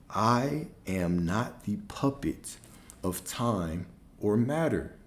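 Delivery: slow, deliberate declaration; emphatic affirmation. Shown as a demonstration. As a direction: spell out the affirmation slowly, word by word. Affirmation declared against doubt.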